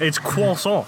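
croissant said the French way, like kwa-SSON; croissant with French kwa sound and silent t; French pronunciation. Shown as a demonstration. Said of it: "Cro-, croissant".

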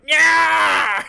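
huge high triumphant yell; gangster whoop. Retold or imitated. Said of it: "he looked like a fucking gangster ... just like".

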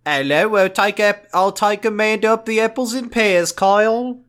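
high, exaggerated Cockney villain; broad fake Cockney, played for laughs; mock Cockney. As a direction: threaten in a silly high Cockney. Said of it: "Australian and Cockney".

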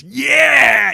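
loud, high whooping cheer; hollering approval at the shirtless driver; toll guy cheer. Retold or imitated. Toll booth guy cheering at the shirtless driver, a loud whoop. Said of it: "sees me with my shirt off and goes".

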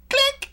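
sharp, high, loud mimicked click; imitated click. Guard shooting at Batman, imitated click. Mimes the gun in acted scene.

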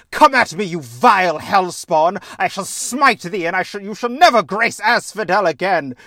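loud, high, hammy hero challenging a foe; pompous Theseus. Retold or imitated. Theseus challenging Zagreus, a loud hammed-up battle cry. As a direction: boom it out like a pompous knight. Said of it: "Theseus comes and be like".

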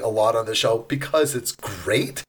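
ordinary talk

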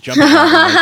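giddy squeal; excited. Retold or imitated. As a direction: bounce with puppy-like glee. Excited dog greeting wife.

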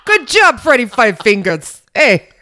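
loud, hammed-up Italian wiseguy. Praising the cohost's reading, in a loud hammed-up Italian wiseguy voice. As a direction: praise him like a mob boss, hammed up. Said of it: "a really good impersonation".

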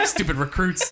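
laughing mock sergeant. Correcting the sergeant impression laughing.

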